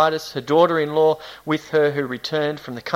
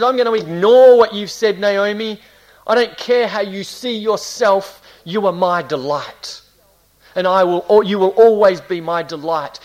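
loud, raised, insistent voice; emphatic declaration; 3 semitones higher, 6 dB louder than usual. Acted. God answering Naomi about who she is, emphatic. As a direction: overrule her with full conviction.

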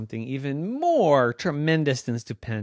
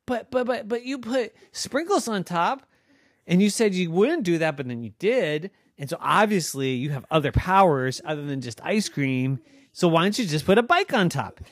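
put-on high, fast, whiny girl voice; arguing girl Noah; 8 semitones higher, 5 dB louder than usual. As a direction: argue like a kid who wants more.

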